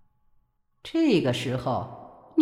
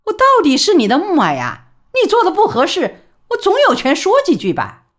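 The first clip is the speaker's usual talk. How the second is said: much higher and louder, insisting on her rights; indignant mother; 12 semitones higher, 7 dB louder, faster than usual. A narrator's reading. Mother asserting her authority to son, loud indignant. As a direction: push back hard at the son.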